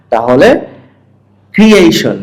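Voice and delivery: usual speaking voice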